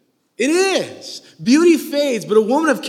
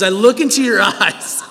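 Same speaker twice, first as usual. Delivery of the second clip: laughing; 5 dB louder than usual. Real, not acted. Quoting Solomon, laughing.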